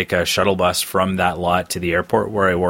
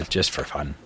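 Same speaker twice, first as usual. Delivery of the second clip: normal talking voice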